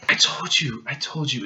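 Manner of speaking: normal talking voice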